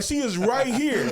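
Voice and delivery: high, loud, drawn-out retort; emphatic insisting. Acted. Cousin arguing with sister about her friend, emphatic insisting. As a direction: stretch and punch each word to win the argument.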